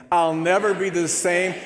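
bold repeat. Shown as a demonstration. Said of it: "say that line again".

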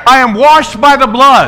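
high, loud declaration for the church to echo; preaching at full force. Real, not acted. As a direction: proclaim it boldly. Leads declaration.

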